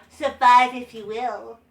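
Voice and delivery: slow, dull mock promo read; slow mock of a flat promo; uncharismatic promo. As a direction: mimic a lifeless wrestling promo. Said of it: "That's how he sounded like".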